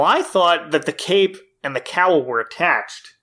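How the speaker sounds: usual speaking voice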